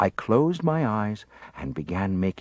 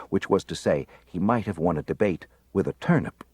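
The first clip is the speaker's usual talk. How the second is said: ordinary delivery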